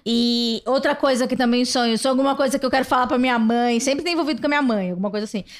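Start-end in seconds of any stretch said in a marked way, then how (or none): none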